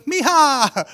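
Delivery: high, loud exclaimed hello; delighted greeting. Retold or imitated. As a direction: call it out, imitating a delighted pastor. Pastor greets the girl delightedly.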